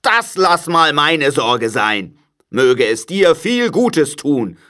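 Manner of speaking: crotchety uncle's slow sneer; grumpy Scrooge, sarcastic. A narrator's reading. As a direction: draw it out with heavy sarcasm.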